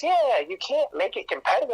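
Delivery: put-on matter-of-fact kid. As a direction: recite it like a kid repeating what she was told. Kid explains field day.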